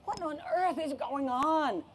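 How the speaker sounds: high, slow, drawn-out disbelief; exasperated cry. Acted. Mom confronting a second dinosaur, exasperated cry. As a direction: stretch every word in high-pitched dismay. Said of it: "my mom cried".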